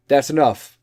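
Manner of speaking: loud, final-sounding order; stern own voice. Shown as a demonstration. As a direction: put your foot down. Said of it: "I'm gonna do it for my voice".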